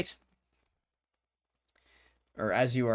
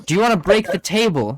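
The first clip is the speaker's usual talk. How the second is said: exasperated exclaim; 8 semitones higher, 12 dB louder than usual. Real, not acted. GM exclaims at huge order.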